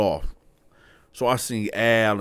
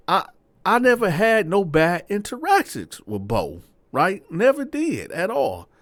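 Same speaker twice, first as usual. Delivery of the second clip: excited; 8 semitones higher than usual. Real, not acted. Excited about meeting old friend.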